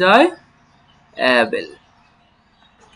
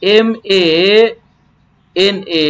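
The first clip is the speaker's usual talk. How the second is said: usual speaking voice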